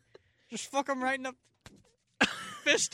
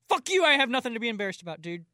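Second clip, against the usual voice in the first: fast, louder defensive snap; 5 semitones higher, 4 dB louder, faster than usual. Acted. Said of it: "I'm getting real defensive".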